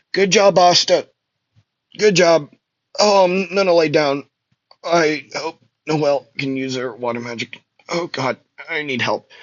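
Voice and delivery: high, strained and weakly spoken, charred and beaten; weak Magna voice. Acted. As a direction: croak it out like a beaten fighter on the ground. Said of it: "he would say".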